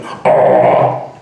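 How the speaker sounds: loud, low growled "oh"; imitated singer's growl. Mark singing along in the helmet, deep loud growl. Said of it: "Mark's going".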